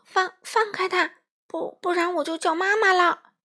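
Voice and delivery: high, stuttering threat; stammering shout. A narrator's reading. Small friend threatening to call mum, stammering shout. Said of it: "欢仔结结巴巴地喊道".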